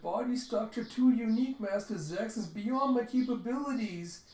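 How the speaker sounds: mock crying robot. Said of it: "Deckman's just crying".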